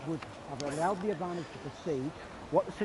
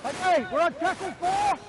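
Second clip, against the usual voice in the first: calling from far away; 8 semitones higher, 6 dB louder than usual. Real, not acted. Player calling on the field.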